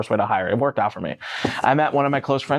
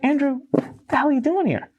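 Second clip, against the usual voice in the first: high-pitched, baffled greeting; retold bewilderment; 12 semitones higher than usual. Finding a friend unexpectedly at the front door, baffled. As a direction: act out being thrown by who is at the door.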